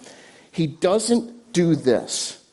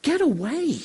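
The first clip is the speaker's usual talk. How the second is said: high-pitched mock angry dismissal; angry crowd's voice, acted at restrained volume; acted anger; 11 semitones higher than usual. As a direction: drive him off, pitch leaping up without shouting. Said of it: "Everybody else is angry".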